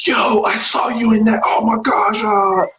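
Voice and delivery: fanboy geek out. Retold or imitated. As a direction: gush like a fan meeting a hero. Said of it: "I truly geek out".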